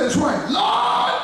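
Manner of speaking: stretched high shout to the Lord; slow, high, pleading shout; slow high cry. Retold or imitated. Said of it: "He said".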